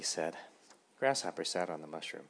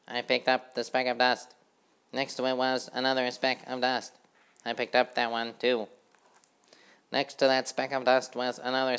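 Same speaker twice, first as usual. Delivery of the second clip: high busy housefly chanting; sing-song of a tidy little fly; 5 semitones higher, 5 dB louder than usual. A narrator's reading. Housefly sweeping the road, chanting brightly and high.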